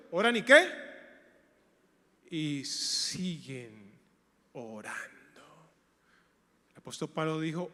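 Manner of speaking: ordinary talk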